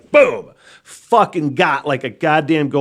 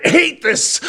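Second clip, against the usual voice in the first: much higher, slow, squeezed-out yell with a laugh; strangled rage; 6 dB louder, much slower than usual. Retold or imitated. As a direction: choke out the fury comically. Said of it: "And I'm just like".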